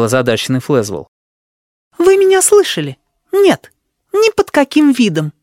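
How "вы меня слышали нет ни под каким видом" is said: high, loud, emphatic refusal; insistent. Acted. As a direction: hammer the refusal home.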